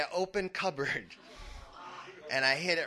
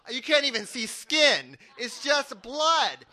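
normal talking voice

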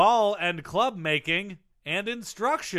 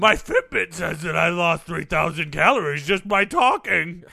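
Tubby Tom character voice; 6 dB louder than usual. Acted. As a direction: boast about the weight loss in character. Guest stays in Tom character.

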